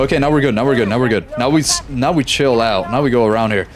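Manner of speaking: normal talking voice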